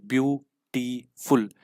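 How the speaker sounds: Beautiful drawn out slowly, each syllable apart. Shown as a demonstration. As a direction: stretch beautiful out to show its syllables. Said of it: "मुझे बोलना कैसे पड़ रहा है beautiful?".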